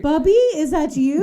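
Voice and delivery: high, loud and laughing; mock sentimental robot. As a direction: ham up a robot meeting its old relative. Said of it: "joining the Terminator-grandpa joke".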